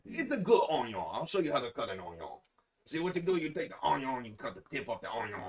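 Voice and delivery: high, loud imitation of an onion-cutting demonstrator; hammed-up how-to voice, pitched well up; video impression. Quoting a video to friends, high loud put-on voice. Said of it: "Have you seen that video?".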